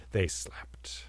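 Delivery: soft unvoiced whisper; hushed. A narrator's reading. Storyteller hushing as the couple falls asleep. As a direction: whisper them to sleep.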